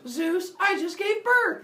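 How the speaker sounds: very high, slow nymph news. Acted. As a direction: stretch it out high and amazed. Nymph reports birth.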